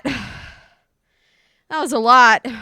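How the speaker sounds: "That was a lot" stretched very slow and loud; drawn-out sigh. Real, not acted. Streamer exhausted after the cat fight, drawing it out slowly. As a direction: drag out "lot" like you're exhausted.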